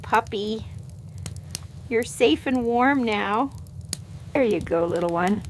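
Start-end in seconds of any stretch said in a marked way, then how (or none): none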